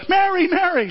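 calling out. Retold or imitated. George calling for wife.

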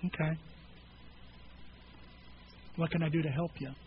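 soft, low, unhurried reply; quietly reassuring; gentle offer of help. Acted. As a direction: act out a calm, supportive listener. Models a gentle listening friend.

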